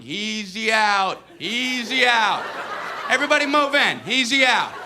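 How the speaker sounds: drawn-out, high taunting holler; kids yelling. Retold or imitated. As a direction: imitate fielders jeering at a weak batter. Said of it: "they're all out there yelling".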